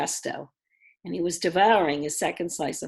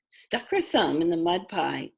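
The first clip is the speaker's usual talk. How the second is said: usual speaking voice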